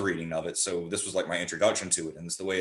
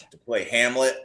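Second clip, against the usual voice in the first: ordinary delivery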